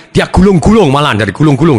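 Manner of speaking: ordinary talk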